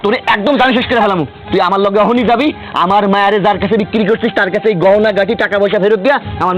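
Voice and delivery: high-pitched angry orders; furious threat. Acted. Father orders his wife to take him to his sold daughter, furious. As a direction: lay down a threat without letting up.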